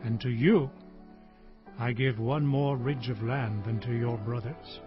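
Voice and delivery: low, subdued last bequest; dying patriarch. Acted. Israel near death bequeaths land to Joseph, low and grave. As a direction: speak low and unhurried, softening toward the end. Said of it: "I am about to die".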